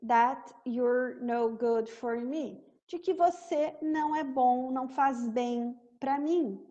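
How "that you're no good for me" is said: that you're no good for me, bem devagar; slow demo. Shown as a demonstration. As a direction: recite the lyric slowly, word by word. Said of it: "I should know é eu deveria saber". (demonstração lenta da letra)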